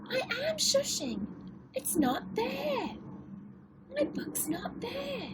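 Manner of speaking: put-on high excited little girl voice, pleading; little sister Lola. Lola begging Charlie for her favourite book, high and pleading. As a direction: plead like an eager small girl. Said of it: "She says".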